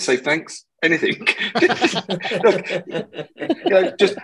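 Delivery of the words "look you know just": laughing through the words. Real, not acted. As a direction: break into real laughter mid-story. Laughing while retelling the story.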